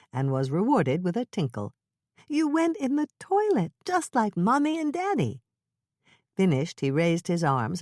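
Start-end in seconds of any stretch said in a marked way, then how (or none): 2.3-5.4 high sing-song praise; praising a toddler. Acted. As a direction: coo it to a little boy.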